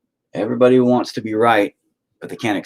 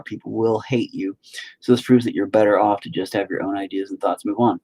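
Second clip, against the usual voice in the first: ordinary talk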